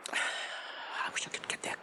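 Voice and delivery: breathy whisper, voice barely there; soft weary whisper about ending the quest; whispered wish. Acted. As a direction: whisper it to yourself, longing to be done. Frodo's longing near Mordor.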